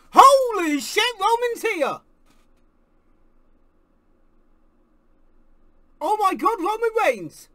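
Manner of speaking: loud high disbelief; shocked shout. Real, not acted. Fan stunned by a surprise arrival, shocked shout. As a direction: gasp it out loud.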